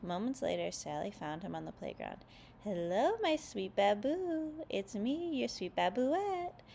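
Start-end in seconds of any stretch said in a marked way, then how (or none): 2.6-6.6 high, slow, lovey-dovey coo. A narrator's reading. Sally coos to Linus on the playground, high and slow. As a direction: coo it sweetly, stretching the words. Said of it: "she cooed".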